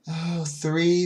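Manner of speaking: usual speaking voice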